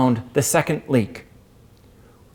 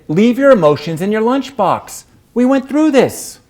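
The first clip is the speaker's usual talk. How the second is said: firm scolding reminder; 7 semitones higher, 4 dB louder than usual. Retold or imitated. Jane scolding the teary narrator, higher and louder. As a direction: Leave your emotions, pitched up and louder, chiding. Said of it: "Jane said".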